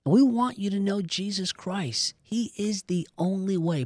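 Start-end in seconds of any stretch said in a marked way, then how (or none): none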